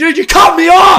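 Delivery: real high, loud protest; indignant shout. Real, not acted. Storyteller to friend who cut in, indignant shout.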